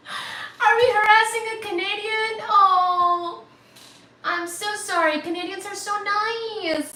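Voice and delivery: high, wailing mock guilt; gushing apology. Gushing mock guilt toward Canadian.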